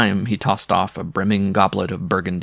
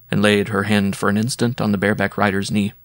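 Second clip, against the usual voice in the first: plain reading voice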